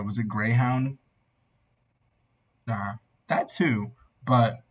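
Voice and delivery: usual speaking voice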